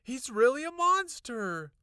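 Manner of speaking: high loud insistence; insistent cry. A narrator's reading. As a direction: plead urgently. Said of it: "I insisted".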